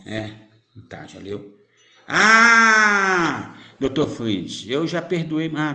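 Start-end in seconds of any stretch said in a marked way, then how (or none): 2.1-3.6 loud call. Real, not acted. Calling out to Doutor Fritz.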